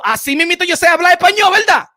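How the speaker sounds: high, loud and fast mocking tag on "así mismito yo sé hablar español"; sarcastic jab. Real, not acted. To critics who doubt his Spanish, loud sarcastic mockery. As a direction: throw the line back at them sarcastically.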